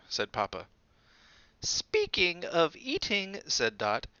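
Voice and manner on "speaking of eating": pointed. A narrator's reading. Dot's pointed remark.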